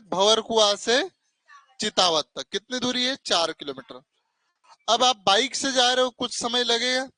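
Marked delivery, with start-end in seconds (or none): 0.0-2.5 drawn out so the class can follow; slow drawn-out answer. Shown as a demonstration. Teacher slowly spelling out the place name for students. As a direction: say भंवर कुआं से slowly, each word stretched. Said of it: "कहां से कहां तक?".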